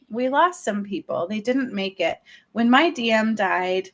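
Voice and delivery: ordinary talk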